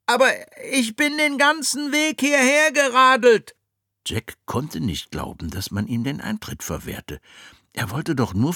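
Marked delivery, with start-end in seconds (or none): 0.0-3.5 put-on boy voice. Jack pleading.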